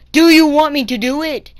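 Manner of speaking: very loud, high mock challenge; imitated threat. Bus driver daring kid, loud high imitated threat.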